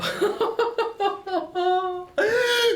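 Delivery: shrieking high laugh; high laughing burst. Real, not acted.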